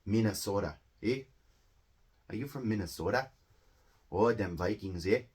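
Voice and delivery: Midwestern Minnesota accent with long o's. Shown as a demonstration. Teacher imitating a famous Midwestern accent, Minnesota accent. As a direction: put on a folksy Minnesota accent. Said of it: "famous Midwestern accents is gonna be like".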